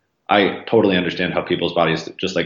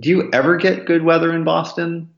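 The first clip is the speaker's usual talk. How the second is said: incredulous question; 9 semitones higher than usual. Retold or imitated. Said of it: "my friend's wife was like".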